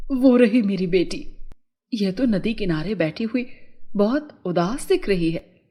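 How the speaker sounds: low mother's voice, concerned; mother fairy. A narrator's reading. Mother spots her sad daughter, low concerned voice. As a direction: pitch down as the mother spotting her child.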